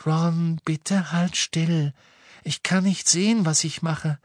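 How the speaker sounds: high, gentle plea; earnest high-pitched girl; light girl's voice. A narrator's reading. Narrator voicing Luna pleading.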